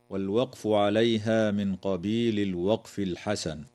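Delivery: normal talking voice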